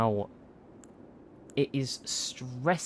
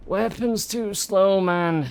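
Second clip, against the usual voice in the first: higher, slow, stretched complaint; slow, exasperated moan at the game; drawn-out whine; 6 semitones higher, 3 dB louder, slower than usual. Real, not acted. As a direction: whine it out, dragging each word.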